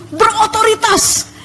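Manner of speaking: "berotoritas" drawn out on a high pitch; emphatic authority. Shown as a demonstration. Preacher describing God's voice, "berotoritas" high and emphatic. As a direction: punch "berotoritas" slow and high.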